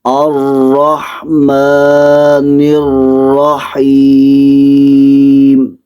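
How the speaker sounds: das Mim in الرحمن einen Alif lang dehnen, langsam rezitiert; gedehntes Mim. Shown as a demonstration. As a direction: Mim in الرحیم lang halten, Koranrezitation. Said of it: "میم کو ایک الف کی مقدار لمبا کر کے پڑھنا ہے".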